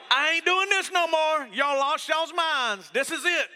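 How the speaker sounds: high, loud, slow shrieking wife; hammed-up screaming mom at the end of her rope; high shrill-free mock scream, dragged out; mock mommy meltdown scream. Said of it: "Angie will just scream at the top of her lungs".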